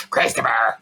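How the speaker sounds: drawn-out smoker-mother impersonation; smoker mother's voice. Retold or imitated. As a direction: imitate a mother who smokes. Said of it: "I always wanna do her voice".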